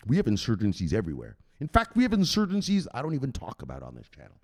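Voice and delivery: ordinary talk, nothing marked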